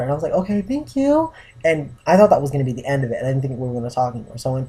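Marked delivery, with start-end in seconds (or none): 0.3-1.3 high, drawn-out sing-song gratitude; sweet thanks. Retold or imitated. Thanking him after he agrees to help, sweetly high and drawn out. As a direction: retell it slowly in a much higher, sweet voice. Retold grateful reply to his offer.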